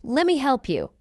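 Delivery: quick, run-together "Lemme help you"; fast English. Shown as a demonstration. Teacher trains learners' ears, fast. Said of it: "fast English is not difficult".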